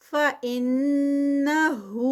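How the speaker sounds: slow, drawn-out tajweed recitation; Quranic recitation. Shown as a demonstration. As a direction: chant it slowly, stretching each sound. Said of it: "ان پہ جو ہے وہ غنہ ہے". (آہستہ کھینچ کر تلاوت)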